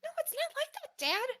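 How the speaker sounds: squeaky Gnomish daughter voice; very high, tiny-voiced protest; really high-pitched. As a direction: protest to dad far up in pitch. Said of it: "like, really high-pitched".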